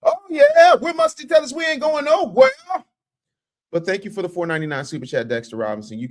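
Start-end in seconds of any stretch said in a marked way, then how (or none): 0.0-2.5 high, loud hype shout; fan shout. Retold or imitated. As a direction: yell it like an excited fan hollering. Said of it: "be like".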